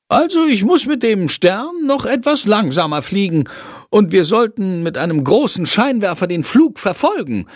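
high-pitched little creature voice; Shandor, self-assured little creature. Acted. Shandor reviewing his flight video, pleased and high-pitched. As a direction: voice a small creature weighing up its performance.

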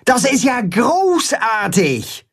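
high, stretched-out delight; excited exclamation. A narrator's reading. Holstein cheers the idea of a party, excited exclamation. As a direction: draw it out high with enthusiasm. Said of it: "ruft Holstein".

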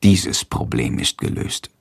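plain reading voice

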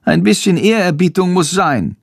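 high-pitched urgent bat cry; high, insistent little creature; bat calling out. A narrator's reading. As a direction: call a halt in a high bat voice. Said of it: "ruft Schandor".

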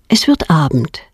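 ordinary delivery

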